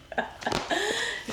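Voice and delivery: a high burst of laughter; high-pitched giggle. Real, not acted. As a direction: laugh it out, amused. Laughing after the game.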